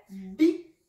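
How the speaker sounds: short clipped bi; bi with a brief vowel. Shown as a demonstration. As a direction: court "bi". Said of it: "Si nous avions une kasra".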